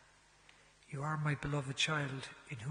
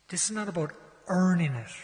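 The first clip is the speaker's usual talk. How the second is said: emphatic realisation; 4 semitones higher, 9 dB louder than usual. Retold or imitated. Insistent realisation to priest.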